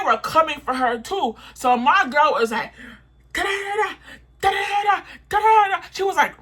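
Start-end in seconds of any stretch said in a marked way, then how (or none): 3.3-5.9 high, rising mock ranting syllables, louder by the end; mimicking her friend going off. Narrator imitates her girl mouthing off at staff, high and heated.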